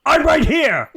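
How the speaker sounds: loud, high, fed-up yell; exasperated shout. Retold or imitated. Hades answering his son, loud and exasperated. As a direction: shout back, annoyed. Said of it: "Hades responds".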